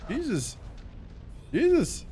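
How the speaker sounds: surprised. Real, not acted. Startled by enemy fire.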